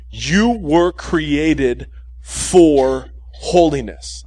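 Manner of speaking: slow, every word spelled out for notes; slow dictation. Shown as a demonstration. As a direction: dictate it slowly so they can write it down. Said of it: "write this down exactly the way I say it".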